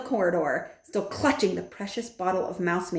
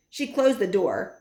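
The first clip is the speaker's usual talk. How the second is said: plain reading voice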